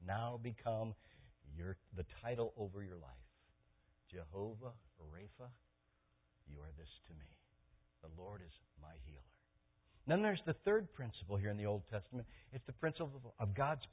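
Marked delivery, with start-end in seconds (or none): 4.1-9.2 very soft, low and unhurried; quiet, low devotional murmur; hushed reverence. Acted. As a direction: say it softly, as if to God. Reverent prayer-like address to God.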